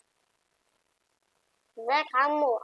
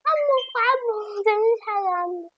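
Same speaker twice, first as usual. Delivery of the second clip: pleading; 8 semitones higher than usual. Pretend. Gamer begs the game uncle not to harm him, pleading high. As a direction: beg him not to hurt you.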